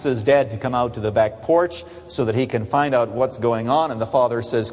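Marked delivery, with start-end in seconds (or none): none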